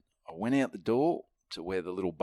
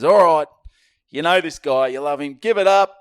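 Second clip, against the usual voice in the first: hype emcee intro; 10 semitones higher, 10 dB louder than usual. Retold or imitated. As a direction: build the crowd up and stretch the name. MC introducing the headliner.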